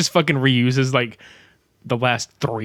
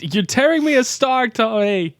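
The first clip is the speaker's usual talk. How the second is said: Tommy Wiseau impression; 11 semitones higher, 3 dB louder than usual. Quoting The Room, pitched up.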